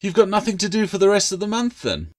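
put-on teasing girl. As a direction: poke fun at him. Claire needles gecko.

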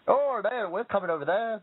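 mock Irish accent, starting high; Irishy accent. British ancestor announcing the trip over, mock Irishy accent. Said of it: "I said it in a rather Irishy accent".

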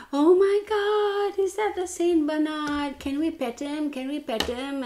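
imitating excited children, high-pitched; excited kids. Kids begging to pet a dog, imitated excitement. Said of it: "these parents and their two kids, and they're like".

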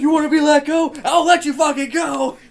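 loud, high menacing yell; threat shout. Acted. Threatening to drop the kid, loud shouting.

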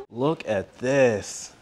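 normal talking voice

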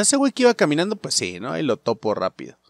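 normal talking voice

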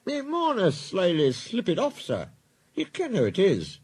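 high Scottish character voice, protesting; Scottish twin engine. Acted. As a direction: explain it away as a Scottish engine.

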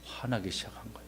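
hushed ominous whisper. A narrator's reading. Teacher narrating rising anger, hushed whisper. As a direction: whisper it darkly.

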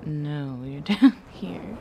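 voicing her own low voice, lightly; a hint of a deep grumble; mock deep voice. Streamer voicing her stubborn deep throat. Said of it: "my voice is like".

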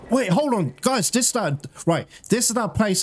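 high, sudden burst of discovery; excited realisation. Real, not acted. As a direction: stop everyone, voice leaping up with excitement.

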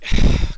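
loud, heavy sigh; exasperated sigh. Real, not acted. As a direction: blow out a frustrated breath. Exasperated with the game.

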